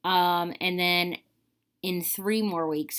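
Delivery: ordinary talk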